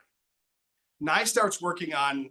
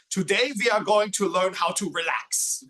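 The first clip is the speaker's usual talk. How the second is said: put-on stiff, instructional heavy German accent, raised pitch and loud; a German relaxation record speaking in a heavy German accent; 4 semitones higher, 5 dB louder than usual. Record teaching an uptight man to relax, heavy German accent. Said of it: "with this heavy German accent".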